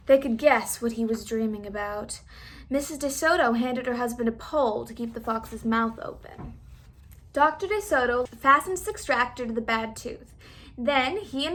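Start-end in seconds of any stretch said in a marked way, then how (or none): none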